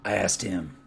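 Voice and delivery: low, soft, scolding foreman voice; stern foreman. Acted. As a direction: scold him quietly from low in the chest.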